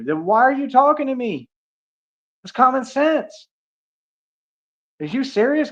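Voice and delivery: can't believe what he's hearing, voice jumping high; incredulous. Real, not acted. Streamer to a chatter he thinks is talking nonsense, incredulous and high.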